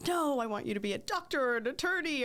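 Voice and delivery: mock pushy parent. Mother jokingly overruling her kids' choices, mock pushy parent. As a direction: play the insisting parent, voice swooping up. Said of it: "as a parent myself now, I'm like".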